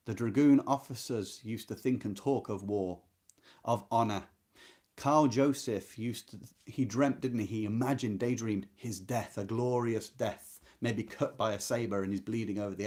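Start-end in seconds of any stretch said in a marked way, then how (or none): none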